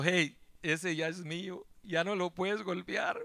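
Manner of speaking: low, slow, quiet warning; softly and slowly claim him as your own; Jesus addressing the devil. Acted. Jesus tells the devil the believer is his, quiet and firm. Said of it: "Y que le dice al diablo".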